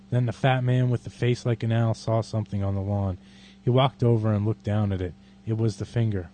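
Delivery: measured dramatic poem reading; poetry reading. Acted. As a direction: read the poem deliberately and darkly. Reads Bukowski poem aloud.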